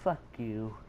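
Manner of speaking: low, soft retort in his head; quiet imagined comeback; unspoken retort. Retold or imitated. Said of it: "I'm like, in my head".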